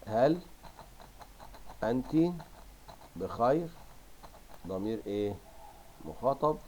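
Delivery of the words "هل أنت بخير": "هل أنت بخير" with a long pause after each word; widely spaced dictation, "بخير" rising. Shown as a demonstration. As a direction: dictate "هل أنت بخير" one word at a time as you write. Dictates question while writing.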